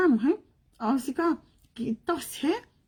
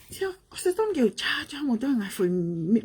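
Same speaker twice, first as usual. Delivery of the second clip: ordinary talk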